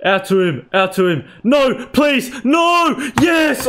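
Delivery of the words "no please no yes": higher, pleading then shouting; beg, then cheer; tense cries. Real, not acted. Gamer watching a chance on goal, tense high cries.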